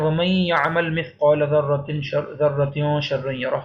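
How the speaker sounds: slow recitation with ومن یعمل merged on a nasal y; idgham recitation. Shown as a demonstration. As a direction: draw out the joined ومن یعمل. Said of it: "اس کو ادغام کر رہے ہیں". (ومن یعمل کو ملا کر ٹھہر کر تلاوت کریں)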